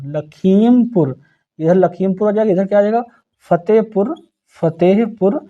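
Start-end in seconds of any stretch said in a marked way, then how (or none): 0.1-1.2 slow dictation. Shown as a demonstration. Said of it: "teacher dictates district name".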